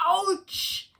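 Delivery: ouch. Acted. Child climbing cries out.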